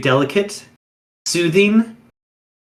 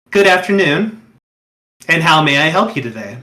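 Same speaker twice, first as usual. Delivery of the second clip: lightly raised, courteous host greeting; a touch of a gracious young wraith welcoming a visitor; polite young host. Acted. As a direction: greet the guest like an eager doorman.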